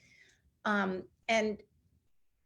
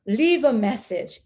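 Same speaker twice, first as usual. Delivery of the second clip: louder mock deep voice; Eric Duro; 5 dB louder than usual. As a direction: imitate a deep-voiced man's voicemail, pushed out. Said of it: "this deep voice".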